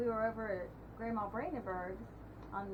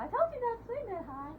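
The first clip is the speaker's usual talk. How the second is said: imitating mom's scolding; 12 semitones higher, 5 dB louder than usual. Mother scolding voiced in story.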